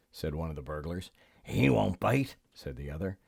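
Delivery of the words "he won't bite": put-on crook voice; burglar. A narrator's reading. Second burglar reassuring the first, put-on crook voice. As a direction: dismiss the danger in a burglar's character voice.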